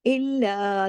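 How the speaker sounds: ordinary talk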